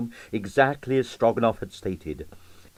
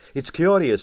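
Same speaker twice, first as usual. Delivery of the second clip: blunt; 6 semitones higher than usual. Acted. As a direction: say it straight out, no softening. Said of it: "said Quill bluntly".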